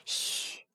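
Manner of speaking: soft voiceless "shh"; hushing shush. A narrator's reading. Princess hushes Huo Shui, a soft shush. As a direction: hush a companion to keep a secret.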